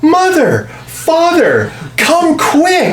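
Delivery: put-on high, shrill-free child voice, very high; overeager. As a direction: overeager kid calling his parents. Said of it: "has the most overeager voice".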